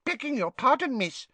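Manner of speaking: high, bowing house elf; a meek little elf; polite elf voice. A narrator's reading.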